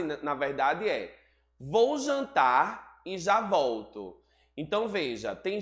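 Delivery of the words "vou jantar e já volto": "jantar" with a sounded final R, slow; clear final R. Shown as a demonstration. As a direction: stress the R at the end of "jantar". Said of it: "esquece desse R do final".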